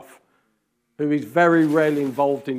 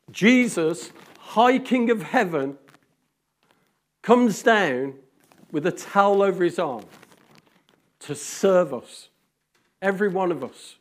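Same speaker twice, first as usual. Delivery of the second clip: louder, higher proclamation, stretched out; rising preacher's cry; full-force preaching; 4 semitones higher, 4 dB louder than usual. Acted. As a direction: proclaim it to the hall. Preaching build-up.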